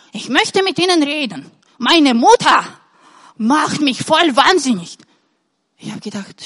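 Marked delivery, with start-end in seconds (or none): 0.1-5.0 lightly voiced old grandpa, higher and louder; a hint of an exasperated old man; old man complaining. Old grandpa at the seminar telling of his mother, mildly exasperated. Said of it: "und sagt".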